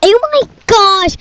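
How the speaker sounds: ordinary talk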